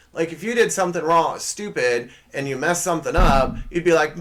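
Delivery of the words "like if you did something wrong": ordinary talk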